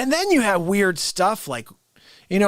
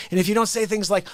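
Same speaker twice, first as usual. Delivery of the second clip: ordinary talk, nothing marked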